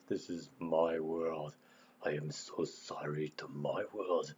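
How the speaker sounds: slow, low, soft mock voice; imitating eerie voice. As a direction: drag it out like a strange creature. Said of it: "Who would talk like that?".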